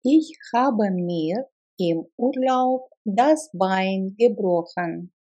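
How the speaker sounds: ordinary talk, nothing marked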